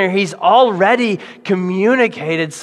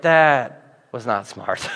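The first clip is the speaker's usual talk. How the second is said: slow regret; 6 semitones lower, much slower than usual. Retold or imitated. Self regret after blurting.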